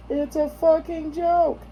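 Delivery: drawn-out, high-pitched protest; slow squeal of insistence; joking protest. Real, not acted. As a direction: stretch it out high, just kidding. Insisting they are only kidding.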